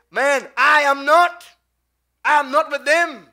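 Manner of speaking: loud, high, slow protest; emphatic denial. Acted. Peter denies being one of the disciples, emphatic loud denial. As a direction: push back loudly, drawing it out.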